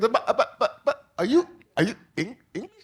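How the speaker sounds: high, stammering mock confusion through a laugh; flustered host. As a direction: stumble over the words in disbelief. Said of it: "not what they expected".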